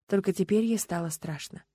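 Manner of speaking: ordinary delivery, nothing marked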